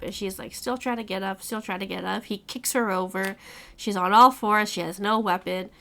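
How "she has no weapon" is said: usual speaking voice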